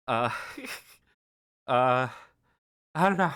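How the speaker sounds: emotion catching in the throat, laughing through it; real emotion breaking through at the end of a long thank-you; getting emotional. Real, not acted. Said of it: "I shouldn't be getting emotional".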